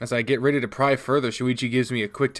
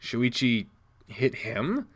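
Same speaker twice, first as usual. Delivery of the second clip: usual speaking voice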